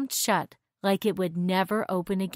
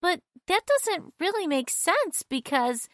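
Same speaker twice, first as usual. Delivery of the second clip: put-on higher young girl voice; 9 semitones higher than usual. A narrator's reading. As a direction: voice Janet as a girl.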